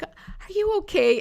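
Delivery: high, drawn-out sympathetic question; concerned caller. Retold or imitated. School staffer asking someone near tears, concerned. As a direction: imitate a caller's careful concern, slow and high-pitched. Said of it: "and they were like".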